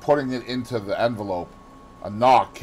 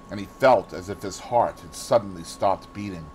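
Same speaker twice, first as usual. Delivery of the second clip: ordinary delivery, nothing marked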